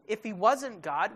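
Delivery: ordinary talk, nothing marked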